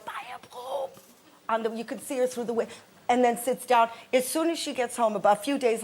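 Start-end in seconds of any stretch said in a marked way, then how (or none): 0.0-0.9 tiny, very high, soft mother voice; still-nagging mother. Retold or imitated. Mother still going at the airport, faint high mock nagging. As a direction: squeak it faintly, as if from far off. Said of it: "She's still going".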